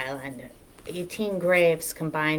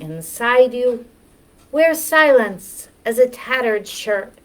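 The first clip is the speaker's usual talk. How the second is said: higher, measured poem-reading voice with lingering phrase ends; poetry reading; 7 semitones higher, 7 dB louder, slower than usual. Acted. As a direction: read the poem out slowly and deliberately, pitched up, pausing after each image. Reads a poem.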